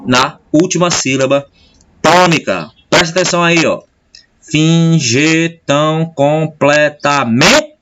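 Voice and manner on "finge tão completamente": "completamente" very slowly, syllable by syllable, rising onto stressed "men"; scanning the syllables. Shown as a demonstration. Teacher scanning a poem verse for students, slow syllables stressing "men". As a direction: split "Finge tão completamente" into slow beats, leaning on "men". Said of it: "Não é mais forte".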